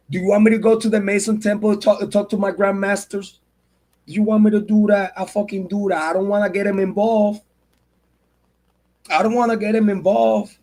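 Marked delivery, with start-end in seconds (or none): none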